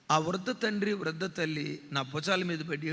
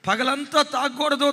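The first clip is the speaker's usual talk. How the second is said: తాగకూడదు pushed out higher and louder; firm resolve; 6 semitones higher, 6 dB louder than usual. Retold or imitated. Son vowing to himself not to drink, raised and firm. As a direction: insist తాగకూడదు with force. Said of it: "ఏడుస్తూ అంటాడట".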